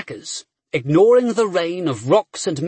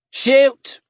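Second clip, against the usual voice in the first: higher, louder sharp command; shout; 8 semitones higher, 6 dB louder than usual. A narrator's reading. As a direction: shout the order to fire. Commander orders volley.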